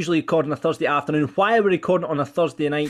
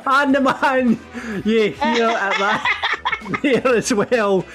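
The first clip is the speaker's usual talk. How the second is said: words squeezed out between laughs, pitched up; laughing through it; 6 semitones higher, much slower than usual. Real, not acted. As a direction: keep talking while still cracking up. Host cracking up at reaction.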